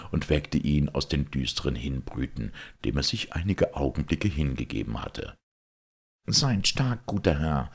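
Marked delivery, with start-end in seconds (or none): 6.3-7.7 a lightly raised, cheerful farmer offering wine; hearty peasant host. Acted. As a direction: urge the lords to drink. Hans comforts the knight.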